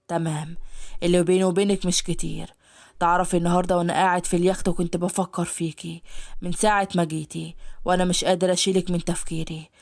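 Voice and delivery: low, intimate male voice; creepy admirer. Acted. Hadi tells the maid he can't stop thinking of her, low. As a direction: draw her in with a low confession. Hadi confesses his obsession.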